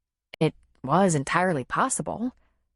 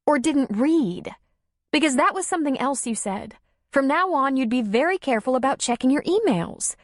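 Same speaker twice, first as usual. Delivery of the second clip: high, doubting echo; sceptical; 7 semitones higher than usual. A narrator's reading. Darla doubting Tom's excuse, sceptical. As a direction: throw his words back.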